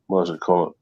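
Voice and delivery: ordinary talk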